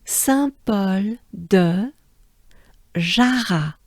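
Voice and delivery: Saint Paul de Jarrat stretched out slowly; careful teacher pronunciation; slow demo. Shown as a demonstration. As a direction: model it slowly for the class. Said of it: "So again".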